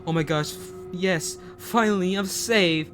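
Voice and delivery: finally free, let it burst out; excited relief. A narrator's reading. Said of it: "Chaz gets excited and says".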